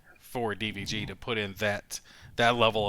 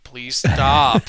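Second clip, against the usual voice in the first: a sudden louder mock plea to stop; 3 semitones higher, 11 dB louder than usual. Husband mock-begging his wife to stop the show, louder. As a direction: beg her to stop, hammed-up.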